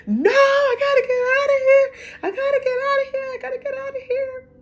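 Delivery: very high frantic wail, fading in volume; re-enacted screaming. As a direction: act out screaming in panic to escape. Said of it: "began to scream and shout".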